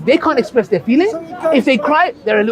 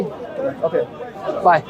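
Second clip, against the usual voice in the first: curt ungrateful brush-off; 8 semitones lower, 6 dB quieter, much slower than usual. Retold or imitated. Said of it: "And I say".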